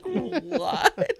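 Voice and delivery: high, drawn-out incredulous question; amazed, laughing at the kid; retold laughing disbelief. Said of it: "I'm like".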